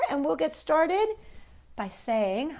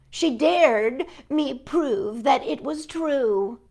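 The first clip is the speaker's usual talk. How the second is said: ordinary talk, nothing marked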